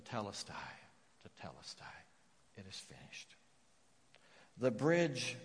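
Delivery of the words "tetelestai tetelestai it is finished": low and very soft, fading; a near-whispered repeat; hushed reverence. Acted. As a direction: breathe the word out reverently. Repeating the Greek word reverently.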